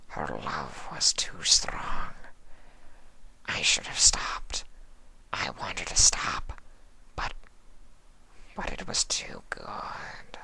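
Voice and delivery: slow fading whisper; remorseful changeling. Acted. Sympathy confessing to Fluttershy, slow guilty whisper. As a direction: confess with guilt, barely audible.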